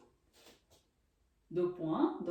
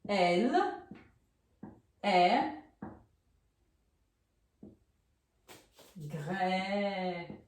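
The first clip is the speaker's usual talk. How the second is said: slow dictation; 3 semitones lower, much slower than usual. Shown as a demonstration. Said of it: "como vai ficar essa frase?".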